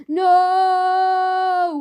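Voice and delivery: high, loud wail of no; drawn-out wail of no. Acted. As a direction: let out a long loud wail. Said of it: "wailed Myrtle".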